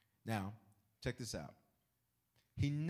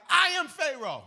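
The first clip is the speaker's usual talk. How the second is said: higher, louder royal proclamation; grand Pharaoh; 15 dB louder than usual. Retold or imitated. Pharaoh declaring himself once more, loud and high. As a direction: announce yourself as king again. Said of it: "he's like".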